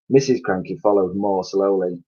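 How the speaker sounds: plain reading voice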